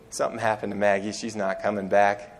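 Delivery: low, soft resigned news. Retold or imitated. Husband telling his wife the dog is gone, low and quiet.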